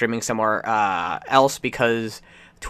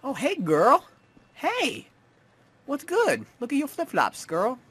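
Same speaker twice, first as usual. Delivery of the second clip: high sassy greeting; 10 semitones higher than usual. Acted. Greeting a passerby in game.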